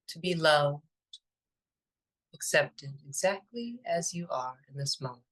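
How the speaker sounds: calm, unhurried meditation voice with long pauses; even, spacious delivery, letting each phrase settle; guided meditation. Acted. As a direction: lead listeners gently inward, phrase by phrase.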